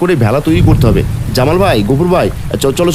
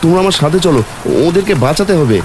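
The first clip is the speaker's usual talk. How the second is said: 1 urgent calling out; 6 semitones higher than usual. Acted. Father calls across the current.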